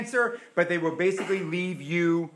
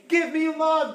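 loud high plea; begging for love; 9 semitones higher, 7 dB louder, slower than usual. Acted. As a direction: cry out for love, pleading. Pleading to the world for love.

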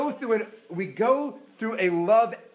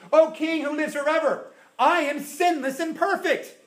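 high, louder mock boast; 10 semitones higher, 5 dB louder than usual. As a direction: play the boast he never made.

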